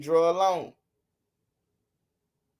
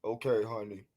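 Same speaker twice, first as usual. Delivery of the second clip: low, soft croaking frog voice; 6 semitones lower, 11 dB quieter than usual. Shown as a demonstration. Streamer teasing chat, in a low croaking frog voice. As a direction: croak it out like a frog, lower and quieter. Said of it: "In my frog voice".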